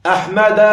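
أحمد said "ahmada", ending on open -a; fatha without tanween. Shown as a demonstration. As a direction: sound a final a on أحمد, no n. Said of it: "فتحة بدون تنوين". (أحمد بفتحة في آخرها بدون تنوين)

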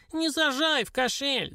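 high, slow squealed plea; shrill little dwarf voice; squealing dwarf. A narrator's reading. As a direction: squeal it like a panicky little imp. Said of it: "заверещал карлик".

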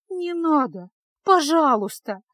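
high begging cry, slowing on the last word; tearful plea. A narrator's reading. Girl pleading with bandits, tearful. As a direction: beg through tears. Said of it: "жалобно молила Феска, заливаясь слезами".

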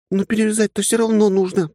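put-on high, insistent young woman's voice. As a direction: press the point as a stubborn young woman. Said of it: "настойчиво возразила девушка".

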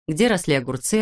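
ordinary delivery, nothing marked

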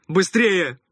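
loud sharp bark; barked order. A narrator's reading. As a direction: bark the command. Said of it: "рявкнул отец".

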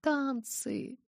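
high, soft, drawn-back "dances"; reluctant high-pitched echo; wincing distaste. A narrator's reading. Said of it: "Я поморщилась".

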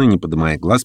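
usual speaking voice

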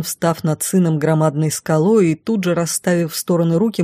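ordinary delivery, nothing marked

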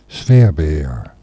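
normal talking voice

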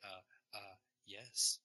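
halting hesitations with long pauses; Jeff Goldblum. Retold or imitated. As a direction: lightly imitate a hesitant actor, stalling before the last word. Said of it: "I just turn into Jeff Goldblum".

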